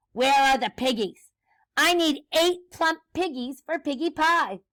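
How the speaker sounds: loud, insistent storybook demand; demanding witch. Acted. Witch demands plump piggies from cow, insistent call. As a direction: push each word out at the animal.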